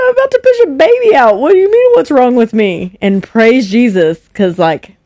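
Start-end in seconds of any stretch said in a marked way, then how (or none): 0.0-3.0 very high, fast indignant outburst; woman in labor yelling back at a careless question; indignant shriek. Retold or imitated. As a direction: screech it back in disbelief. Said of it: "I'm like".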